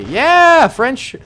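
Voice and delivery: very high, loud whooping cheer. Real, not acted. Cheering the French planes with whoops.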